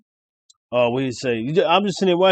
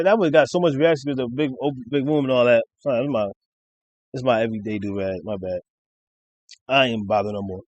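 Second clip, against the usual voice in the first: usual speaking voice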